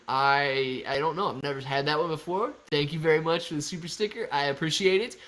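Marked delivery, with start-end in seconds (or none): none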